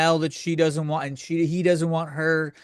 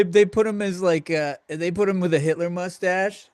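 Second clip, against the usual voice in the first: ordinary talk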